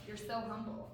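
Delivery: dry, quiet sarcastic jab. Shown as a demonstration. Coach showing kids a sarcastic compliment, quiet and dry. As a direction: say the compliment but mean the opposite. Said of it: "in a way that was maybe sarcastic".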